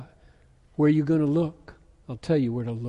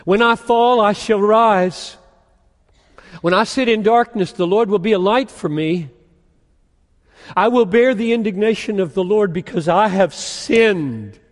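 higher and louder, ringing proclamation; preaching at full force; 7 semitones higher, 8 dB louder than usual. Acted. Preacher proclaiming Micah's hope to the church, full-force preaching. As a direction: thunder the verses out to the congregation.

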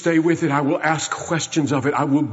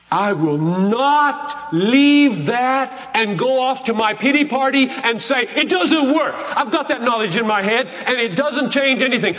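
higher, with a scoffing laugh; mock self-pity; 8 semitones higher than usual. Preacher mimics a self-pitying believer, laughing mockery. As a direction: sneer at the pity-party excuse.

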